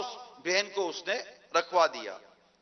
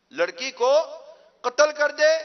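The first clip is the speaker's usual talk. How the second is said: urging command; 5 semitones higher, 5 dB louder, slower than usual. Acted. Devil urges the murder.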